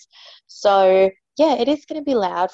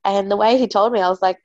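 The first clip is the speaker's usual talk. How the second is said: ordinary talk, nothing marked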